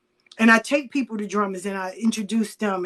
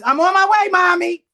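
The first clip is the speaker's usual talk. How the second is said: calling out; 9 semitones higher, 7 dB louder than usual. Retold or imitated. Retold phone call to daughter.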